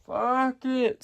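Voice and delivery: fuck it lifted high and soft. Real, not acted. Picking a coast to live on, a high airy fuck it. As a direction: float fuck it up high, breezy.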